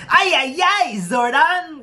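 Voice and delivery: very high cartoon robot-sidekick voice; Alpha. Quoting a Power Rangers character, high-pitched impression. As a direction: squeal it in a high, upbeat falsetto. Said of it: "like, Alpha from Mighty Morphin Power Rangers".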